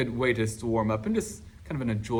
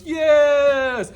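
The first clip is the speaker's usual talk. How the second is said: high, loud shouted yes; class cheer; 14 semitones higher, 10 dB louder than usual. Retold or imitated. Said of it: "everybody goes".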